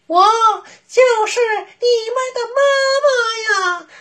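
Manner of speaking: high, pinched fake-sweet voice; a wolf squeezing his throat to sound like mother; thin reedy voice. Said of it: "捏着嗓子细声细气".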